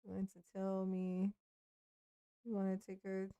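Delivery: ordinary talk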